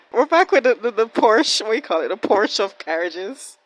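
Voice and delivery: high, loud and delighted; excited. Real, not acted. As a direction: announce it gleefully. Excited return to carriage.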